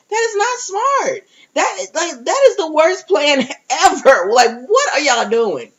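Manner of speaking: high, exasperated disbelief, a touch of a laugh; incredulous retort. Retold or imitated. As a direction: call the plan out, scoffing. Her own retort to a plan.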